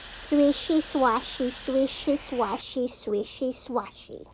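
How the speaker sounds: hushed, trailing swish-swash; fading swishy sounds. A narrator's reading. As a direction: let the grass sounds drift away quietly. Sound words fading through grass.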